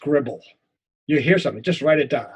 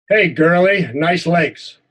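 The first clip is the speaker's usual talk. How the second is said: loud jeering call; a bully jeering at the boy's legs; mocking taunt; 7 dB louder, slower than usual. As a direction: taunt him loudly in front of the gang.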